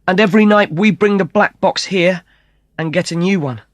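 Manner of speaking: high, quick excited explaining; eager explaining. Acted. Matt and Kate explain the card scam to the brothers, high and eager. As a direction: rattle it off eagerly.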